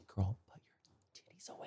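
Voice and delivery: hushed whisper, breathy and urgent. Retold or imitated. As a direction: whisper it sharply across the table. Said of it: "I was like".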